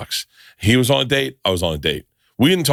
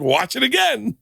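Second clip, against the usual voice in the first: Hutch; 12 semitones higher than usual. Retold or imitated. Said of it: "he was like".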